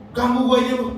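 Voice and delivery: high, loud grumpy retort, joking; mock annoyed Jesus. Preacher jokingly voices an irritated Jesus, loud mock snap. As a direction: snap it like you were rudely woken. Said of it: "Yesus marah".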